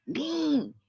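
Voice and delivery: high, winded little croak; raspy. Retold or imitated. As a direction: imitate someone out of breath. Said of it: "The voice is real raspy".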